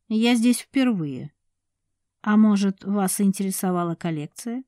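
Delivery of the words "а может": ordinary delivery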